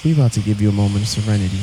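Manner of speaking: calm beach meditation, slowed down; soft echoing dream voice. Pretend. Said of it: "Let my voice guide you".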